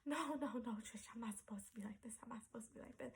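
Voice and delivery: whispered panicked plea to her sister; hushed and scared, begging; breathless fearful whisper; frightened whisper. Retold or imitated. Flipping out to sister.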